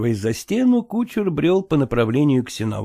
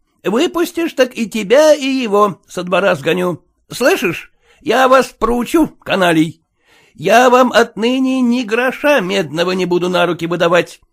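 loud, heated scolding; 7 semitones higher, 5 dB louder than usual. Acted. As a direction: lose your temper at the servants. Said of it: "горячился он".

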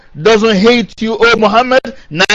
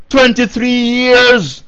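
"Twenty-three years" stretched slowly, pitched higher; emphatic repetition; 4 semitones higher, much slower than usual. Real, not acted. Marvels at the short mission.

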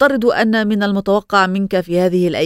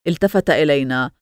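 plain reading voice

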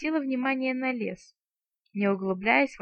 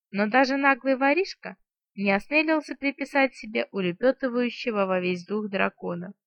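plain reading voice

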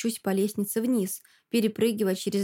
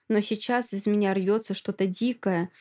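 ordinary delivery, nothing marked

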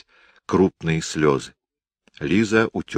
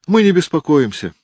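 put-on high, loud boy's voice; a defiant little boy speaking up; child voice; 11 semitones higher, 6 dB louder than usual. Boy tells aunt Liza, child voice.

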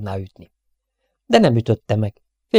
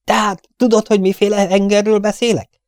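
loud demand; 10 semitones higher, 3 dB louder than usual. Acted. Captain pressing after shout.

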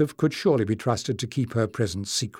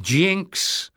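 exasperated interjection, raised in pitch; higher, impatient protest; 6 semitones higher than usual. A narrator's reading. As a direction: cut in wearily on the name. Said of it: "Leaf began in exasperation".

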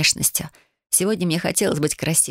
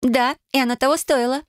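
put-on lightly raised girlish voice; a cheerful young cousin agreeing; 7 semitones higher than usual. A narrator's reading. Cousin answers, higher voice.

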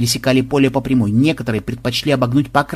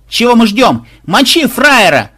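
high loud yell, slowed end; 9 semitones higher, 7 dB louder, slower than usual. A narrator's reading. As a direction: bawl it as a rabble-rouser. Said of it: "завопил кто-то".

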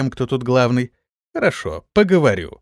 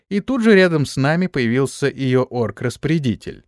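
usual speaking voice

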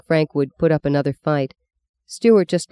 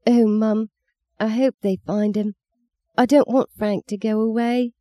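put-on little girl voice; 6 semitones higher than usual. Meggie pleads with mother.

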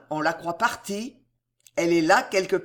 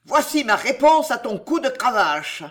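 savage taunt; 5 semitones higher, 5 dB louder than usual. A narrator's reading. Maurevert taunts while striking.

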